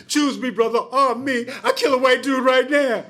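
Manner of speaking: high, eager shouting with a laugh; teen at the Panther meeting begging to be armed, pitched high; overeager recruit. Retold or imitated. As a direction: jump up and volunteer too keenly. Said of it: "I jump up and I was like".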